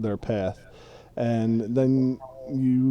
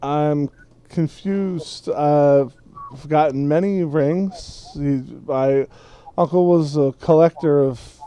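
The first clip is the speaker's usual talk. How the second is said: slow, higher-pitched hobbit explanation; confused tipsy hobbit; 5 semitones higher, 4 dB louder, slower than usual. Acted. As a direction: puzzle it out slowly, pitch raised. Game master plays tipsy Frodo.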